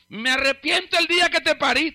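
high-pitched parent's lament; mock a fed-up mother's regret; acted parental outburst. Voices parent's hurtful outburst.

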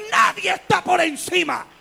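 loud, high pulpit shout; thundering declaration; preaching shout. Acted. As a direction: proclaim it to the back row. Climax of sermon point.

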